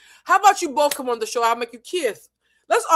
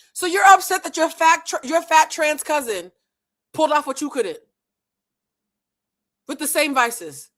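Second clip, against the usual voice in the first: usual speaking voice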